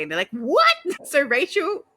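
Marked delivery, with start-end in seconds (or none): 0.3-0.8 high, loud squeak of disbelief; shocked what. Retold or imitated. Others react in shock. 0.8-1.8 laughing. Real, not acted. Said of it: "host laughing".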